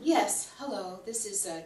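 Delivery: usual speaking voice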